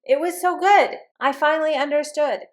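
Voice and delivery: building to high, loud, delighted relief; acted breakthrough excitement, climbing in pitch; excited answer. Acts out a student's excited answer.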